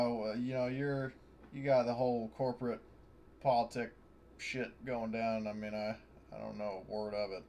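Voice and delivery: usual speaking voice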